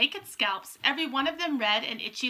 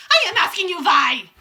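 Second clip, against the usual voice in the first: high, very loud witch shriek; witch screaming; 7 semitones higher, 12 dB louder than usual. A narrator's reading. Witch demanding answers from her coven, screaming. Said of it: "she screamed".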